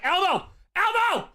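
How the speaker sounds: very high, loud mock shriek; loud falsetto call of the name; imitated crowd call. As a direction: squeal the name like excited fans. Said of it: "I know everyone was like".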